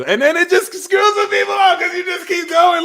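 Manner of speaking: very high, loud shouted rant; excited rant. Real, not acted. Reader ranting to viewers, very high loud shout. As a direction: blast it out way up high.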